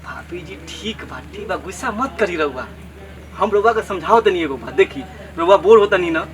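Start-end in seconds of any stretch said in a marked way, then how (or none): 0.0-2.7 soft, hushed pacifying voice; placating. Acted. Villager calms his angry sister-in-law, soft and placating. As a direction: back off and soothe gently.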